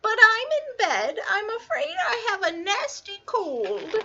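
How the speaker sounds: high, scratchy, sickly fake granny. A narrator's reading. Wolf feigning a cold in grandmother's bed, scratchy high voice. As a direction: croak it out in falsetto as a poorly grandma. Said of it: "came a scratchy voice".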